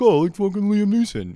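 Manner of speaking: high, loud mock-dopey outburst; dummy voice. Starstruck dummy reacting to the caller's accent, high and loud. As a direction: blurt it like an excited idiot. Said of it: "You just sound like a dummy".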